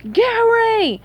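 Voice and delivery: high, loud drawn-out yell. A narrator's reading. Patrick calling to Gary through the window, yelling. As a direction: holler the name. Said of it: "Patrick yelled".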